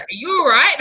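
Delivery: a hint of a worried waiter; concerned waiter. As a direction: play the waiter checking on a crying guest. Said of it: "And he's like".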